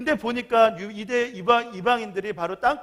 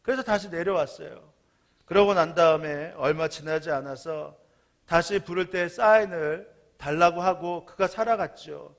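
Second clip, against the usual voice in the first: ordinary talk, nothing marked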